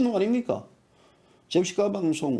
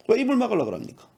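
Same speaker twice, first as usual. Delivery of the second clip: ordinary talk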